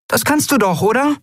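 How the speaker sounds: very high-pitched little voice; eager Nelen character voice. A narrator's reading. Nelen urging the group to reprogram him, high and eager. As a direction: pipe up high with an eager idea. Nelen's figure voice asks Ailan.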